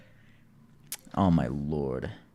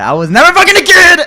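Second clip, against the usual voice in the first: high, loud burst of protest; shouted denial; 13 dB louder than usual. Real, not acted. Streamer corrects his awkward phrasing, shouted protest.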